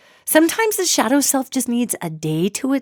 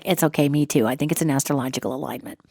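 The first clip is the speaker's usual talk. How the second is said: lower, softer, offhand reply; deadpan friend; 7 semitones lower, 6 dB quieter, faster than usual. Pretend. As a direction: shrug it off like a calm friend. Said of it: "your friend's like".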